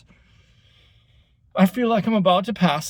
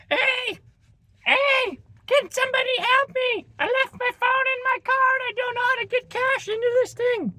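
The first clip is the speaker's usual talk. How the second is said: much higher, whiny put-on Karen; mock Karen voice. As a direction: lampoon the helpless customer in a high voice. Mocking the Karen at the kiosk.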